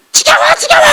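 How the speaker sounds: mock exasperated wife screaming enough already; fed-up shout. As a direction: shriek it like a sick-of-it wife.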